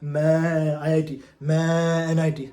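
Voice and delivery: slow, drawn-out goat bleat on मैं. Acted. Schoolboys herded into engineering like goats, mock bleating. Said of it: "बकरों की तरह".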